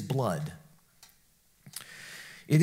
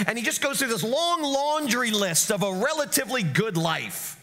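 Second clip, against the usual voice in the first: ordinary talk, nothing marked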